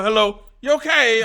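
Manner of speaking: a hint of a chirpy Scouse clerk; imitating shop greeting. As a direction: parrot the local shop greeting. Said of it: "I walk in the store".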